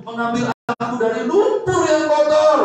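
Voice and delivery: high, loud shout of praise; preaching shout. Acted. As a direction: cry it out to the congregation.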